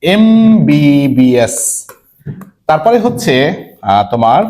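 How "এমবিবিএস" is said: এমবিবিএস stretched out letter by letter, starting on a clear "em"; teacher spelling out এমবিবিএস at a crawl; very slow spelled-out demo. Shown as a demonstration. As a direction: draw out এমবিবিএস very slowly so the class hears the "em". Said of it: "আমি বলছি যে".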